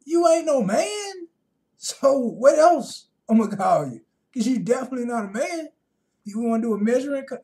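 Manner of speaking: hammed-up incredulous yell; mock outrage. Joking to women who object to being called female, with loud mock outrage. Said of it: "No, I'm just joking".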